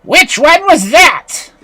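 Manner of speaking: high, slow, loud demand; demanding witch. A narrator's reading. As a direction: shout the question imperiously. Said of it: "the witch was demanding".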